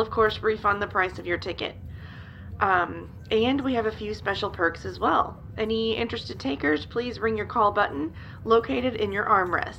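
loud, formal cabin-crew announcement over the PA. Acted. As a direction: address the whole cabin like a flight attendant. In-flight announcement to passengers.

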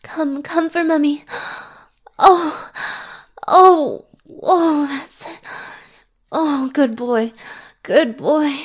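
loud, high, breathless exclamations; ecstatic cries. Acted. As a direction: let it burst out at the peak. Climax cries.

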